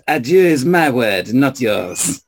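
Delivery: put-on French-accented protest; mock-possessive French stranger. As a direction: claim the word back with a French accent. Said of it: "unless you're with a French person".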